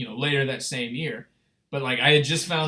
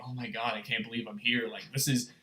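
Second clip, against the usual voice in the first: awestruck hush; 4 semitones lower, 8 dB quieter than usual. Retold or imitated.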